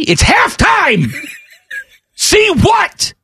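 high, drawn-out sports-fan shout; yelling fan. Acted. Acting fan yelling back at complainer.

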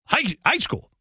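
"high" cut short, then "high school" rushed; too-quick imitation. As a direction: stutter out an overcorrected, hurried "high school". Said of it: "too quick".

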